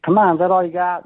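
high, loud taunt to the crowd; defiant taunt. Retold or imitated.

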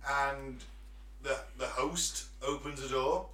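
normal talking voice

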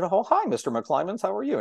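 high, polite phone hello; eager greeting. Retold or imitated. Teen taking the station manager's call, high and eager.

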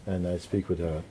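usual speaking voice

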